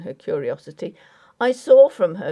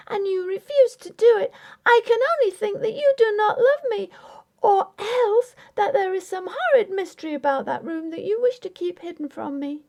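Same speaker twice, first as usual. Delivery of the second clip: put-on high, pleading young wife voice; raised voice of a wounded young bride; young wife Grace; 12 semitones higher than usual. A narrator's reading. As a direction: voice Grace, hurt and insistent. Grace's voice in story reading.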